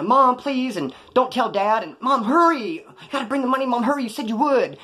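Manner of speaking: high, loud pleading whine; mock a grown son begging his mother on the phone; imitated whining. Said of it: "he'd be calling and whining".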